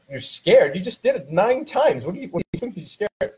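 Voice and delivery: can't believe he's scared after nine jumps; incredulous dad. Retold or imitated. Father to son who jumped nine times, incredulous. Dad disbelieving son's fear.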